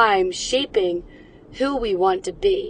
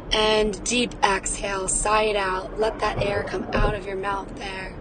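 guided breathing. Acted. Guides listeners through deep breaths.

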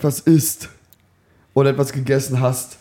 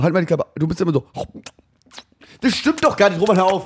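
imitating brother. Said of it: "ich mach das einmal".

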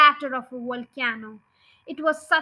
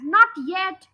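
shouting; 5 semitones higher, 5 dB louder than usual. Acted. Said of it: "shouted Bogus".

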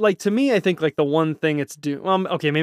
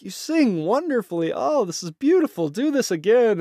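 forced polite praise; 8 semitones higher than usual. Retold or imitated. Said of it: "put that smile on his face and be like".

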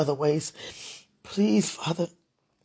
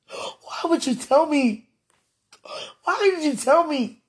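anguished protest; 8 semitones higher, slower than usual. Real, not acted. Rising anguish at God.

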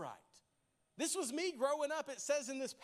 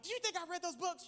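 put-on incredulous kid; 6 semitones higher, faster than usual. Kid answering the principal.

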